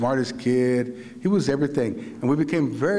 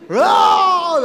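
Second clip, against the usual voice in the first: very loud, high mock scream; imitated child's scream; 14 dB louder than usual. Terrified five-year-old screaming in the bunks, imitated scream. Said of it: "I start screaming".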